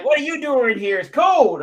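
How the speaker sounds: high, loud mock American; terrible American accent. Local man questioning a visitor out in the cold, mock American accent. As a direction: put on a hammed-up American accent. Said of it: "that terrible American accent".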